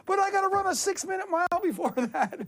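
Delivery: high-pitched, giddy retold phone call; excited announcement. Athlete calling the coach next day, high and giddy. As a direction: pitch it way up, breaking the crazy plan. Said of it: "I call my coach".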